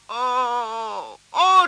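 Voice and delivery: high, slow moan. Acted. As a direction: moan with regret. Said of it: "gémit Abigail".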